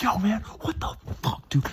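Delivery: high-pitched, outraged driver rant; mock road rage. Driver replaying his past self confronting another driver, angrily. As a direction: act out blowing up at another driver. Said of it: "in the past, I'd be like".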